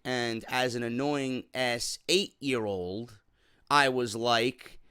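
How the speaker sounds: ordinary talk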